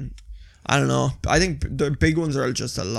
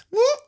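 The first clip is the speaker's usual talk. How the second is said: short rising whoop; imitated dog noise. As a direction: imitate a dog's odd little whoop. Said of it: "this stupid fucking noise".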